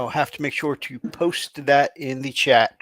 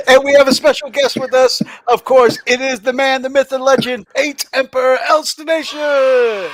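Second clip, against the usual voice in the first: raised, ringing guest introduction; announcer intro; 9 semitones higher, 6 dB louder than usual. Real, not acted. Host introducing a special guest to viewers, hyped announcer voice. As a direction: build up the guest like a ring announcer, higher and louder.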